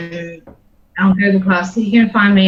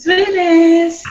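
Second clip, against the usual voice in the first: high, bright mock echo; playful echo; 8 semitones higher than usual. Real, not acted. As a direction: toss the word back in fun. Echoing playful exclamation.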